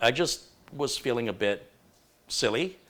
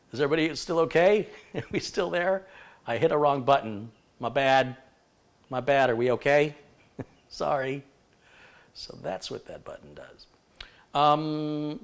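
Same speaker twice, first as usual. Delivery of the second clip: ordinary talk, nothing marked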